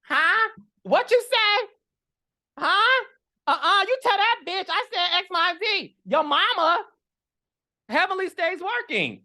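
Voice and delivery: high, sassy impression of Heavenly mid-drill; imitating Heavenly. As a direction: squeal it out high like a diva dentist throwing shade. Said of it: "This be Heavenly drilling teeth".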